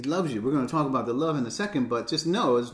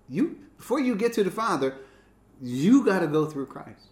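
fervent preaching; 7 semitones higher, 5 dB louder than usual. Real, not acted. Preacher stressing the point.

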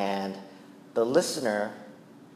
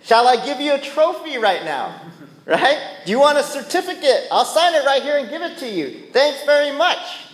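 higher sarcastic taunting voice; sarcastic mockery; 10 semitones higher than usual. Preacher mocking someone fishing for credit, higher and sarcastic. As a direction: offer the trophy mockingly. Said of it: "you say".

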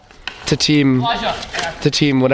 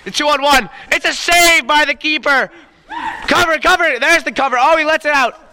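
excited commentary; 9 semitones higher than usual. Acted. Rising excitement in call.

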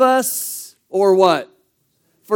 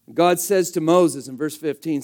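ordinary talk, nothing marked

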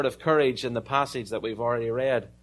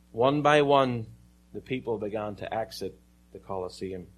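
usual speaking voice

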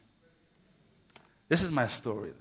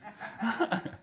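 high, breathy laugh; amused laugh; 10 semitones higher than usual. Real, not acted. Preacher amused by his own certificate joke, laughing.

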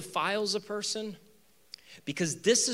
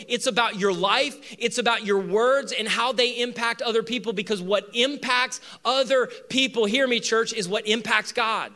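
higher, louder pulpit drive; rising sermon peak, higher and louder; full-force preaching; 7 semitones higher, 4 dB louder than usual. Acted. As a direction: preach it hard to the church.

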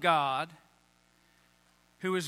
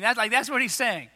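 ordinary talk, nothing marked

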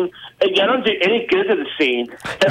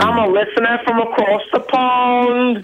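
a slow put-on English caller; fake British accent. Caller introducing himself to the hosts in a fake British accent. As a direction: lay the British accent on thick. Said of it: "the only English person this guy knew".

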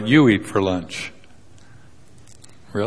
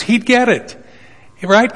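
mock expectation; 10 semitones higher than usual. Real, not acted. Mocking expectation of Peter.

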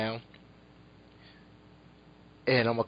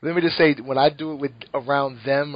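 ordinary delivery

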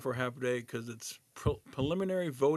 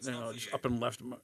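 normal talking voice

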